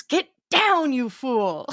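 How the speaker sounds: hammy movie-hero hiss of an order; a hint of Frederick's panicked command; quoting Frederick. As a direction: Get down snapped out high. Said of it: "Frederick goes".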